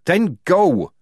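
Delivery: Polly losing her temper with Tonka; shouted. A narrator's reading. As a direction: bark it at her. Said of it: "shouted Polly".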